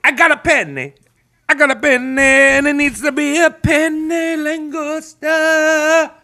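high, insistent character voice; mock desperate begging; hammed-up pleading. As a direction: whine it high, stretching the last words out. Acts Frank butting in on Mickey.

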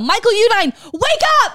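high, loud call-out; exasperated shout. Real, not acted. Calling out Michael Udine over LaMarca, exasperated shout. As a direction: yell it up high, fed up. Ranting at absent politician.

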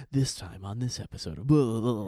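loud podcast-intro patter; mock show announcer. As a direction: parody an episode intro, trailing into blahs.

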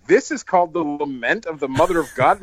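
mock disbelief; incredulous question. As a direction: echo the title as if it's absurd. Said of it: "and I was like".

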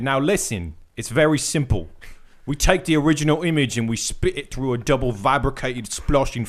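put-on British accent that keeps drifting; hammed-up, unsteady British storytelling; wandering British accent. Host reads a producer's anecdote aloud, wandering British accent. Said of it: "I think I changed, like, four times".

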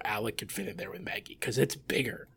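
conspiratorial whisper. Real, not acted. Joking to co-hosts about the cramped cockpit, whispered. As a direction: whisper it like a cheeky secret.

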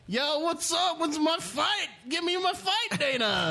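mock Covington badgering Dana for a fight; Covington impression. Covington hassling Dana at his blackjack table, high pushy heckle. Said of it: "Covington's like".

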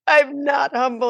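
insisting to the friar through sobs; retold crying protest. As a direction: cry it out in protest. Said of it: "bawling in the church".

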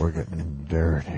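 low gravelly mock growl; deep growl.

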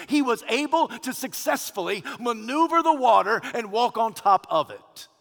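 fervent preacher build; rising preaching. Acted. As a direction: lift the room as he walks on water.

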